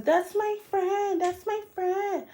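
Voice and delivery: high, soft mimicking voice; imitating a friend's high-pitched plea; mock pleading.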